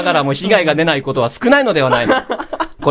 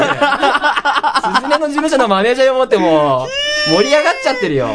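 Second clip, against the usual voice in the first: laughing to tears; 7 semitones higher, faster than usual. Real, not acted. Laughing till near tears.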